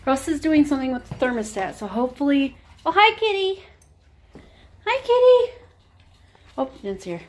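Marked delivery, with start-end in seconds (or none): 2.9-5.5 high greeting to the cat; pet voice. Real, not acted. Greeting the kitty in a high pet voice. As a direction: squeak hello up high.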